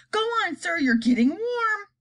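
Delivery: high excited spy voice; spy encouraging. Acted. Chief spy cheers on the president's guess, high and excited. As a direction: cheer him on. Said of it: "cried the chief spy".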